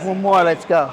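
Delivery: loud self-encouragement mid-lift; psyching myself up. Real, not acted. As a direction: pump yourself up for the last rep. Lifter psyching up mid set.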